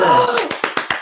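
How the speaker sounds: high, sharp excited gasp. Real, not acted. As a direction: gasp with delight at the news. New member joins, delighted.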